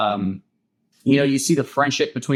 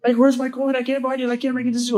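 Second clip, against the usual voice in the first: high, frantic mock panic; retold freak-out, pitched way up; Two-Face freaking out; 12 semitones higher than usual. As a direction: imitate a villain panicking in a strained high pitch. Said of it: "he's freaking out".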